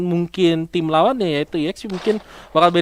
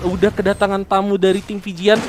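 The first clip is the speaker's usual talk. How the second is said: ordinary talk, nothing marked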